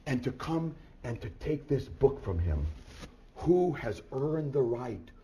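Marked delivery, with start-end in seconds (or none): none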